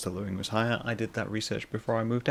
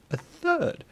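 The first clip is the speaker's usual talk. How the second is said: laughing. Real, not acted.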